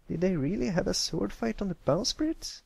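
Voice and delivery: in wonder. Acted. Scootaloo asks Pipsqueak about the sword fight, in wonder. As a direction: ask full of wonder. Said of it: "Scootaloo said in wonder".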